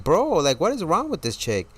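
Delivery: high-pitched retold exasperation; exasperated disbelief. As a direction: act out baffled annoyance, pitch jumping up. Retelling exasperation at waitress.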